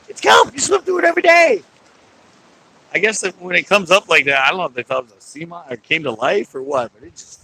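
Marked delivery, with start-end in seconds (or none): 0.1-1.6 very high, loud incredulous cry; comic high-pitched protest; mock exasperation. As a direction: holler it like reasoning with silly animals. Acts out scolding the sea lions.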